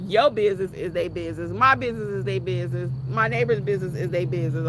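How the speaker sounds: loud, emphatic family motto; loud insistence. Retold or imitated. Declaring her family's nosy motto out loud. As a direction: hammer it home loudly.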